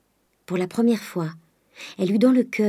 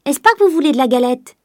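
higher, louder call through the window; 6 semitones higher, 7 dB louder than usual. A narrator's reading. Nanon calls to Mademoiselle about the galette, loud high call. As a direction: keep calling up to her, loud and high. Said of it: "cria-t-elle par la croisée".

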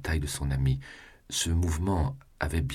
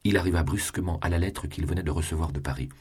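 ordinary delivery

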